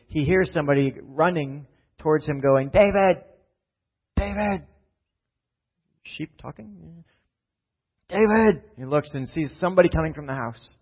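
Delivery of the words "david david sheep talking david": high shouted calls of David; distant calling, high and loud. Acted. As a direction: yell his name across a field. Messenger shouting from afar.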